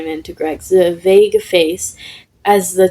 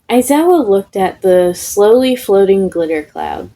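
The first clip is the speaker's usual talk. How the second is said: plain reading voice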